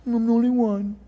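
sulky self-pitying whine; pouting Elijah. Retold or imitated. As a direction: sulk like a child in a cave. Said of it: "he pouts".

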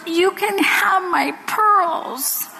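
high, slow, drawn-out surrender; acted tearful child. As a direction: imitate a sobbing little girl giving in. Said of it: "She's sitting on the bed sobbing".

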